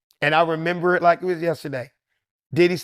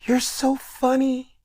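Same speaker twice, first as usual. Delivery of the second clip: [name]; 7 semitones higher, slower than usual. Retold or imitated. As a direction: draw it out in a high, delicate falsetto. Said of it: "looks me dead in my face and goes".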